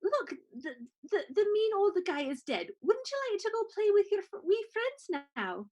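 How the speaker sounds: a hint of talking to a small child; gently coaxing a little creature. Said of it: "I pat it on the head and I say".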